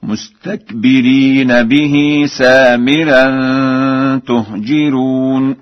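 slow chant, kasra on the jim of تهجرون; Warsh recitation. Shown as a demonstration. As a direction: recite with a light, thin ra in تهجرون. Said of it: "وكسر الجيم وترقيق الراء هكذا". (تلاوة مرتلة بكسر الجيم وترقيق الراء في تهجرون)

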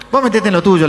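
high, loud comeback; mock angry retort. Moviegoers telling protester to butt out, loud mock anger. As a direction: imitate someone telling off a meddler. Acted quarrel, snapping back.

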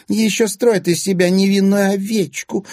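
high, loud, indignant jeer; exclaiming. A narrator's reading. Egor's wife accusing her stepson, exclaiming indignantly. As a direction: cry out in scornful outrage. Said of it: "воскликнула жена Егора".

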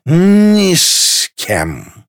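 high, hugely drawn-out words; drunken insistence. A narrator's reading. Drunk girl repeats her vow, wailing slowly. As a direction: stretch it out, sloshed.

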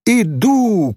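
high answering holler; drawn, high-pitched girl's call; calling back. A narrator's reading. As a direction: shout back as if across a yard. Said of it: "крикнула она".

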